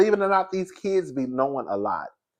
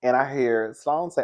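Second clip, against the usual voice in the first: ordinary talk, nothing marked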